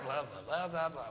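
ordinary talk, nothing marked

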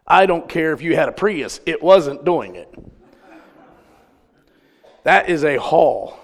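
ordinary talk, nothing marked

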